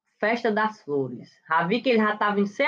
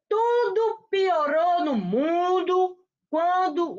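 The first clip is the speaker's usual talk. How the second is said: mock wailing lament; 5 semitones higher, much slower than usual. Mock lament over celebrity split.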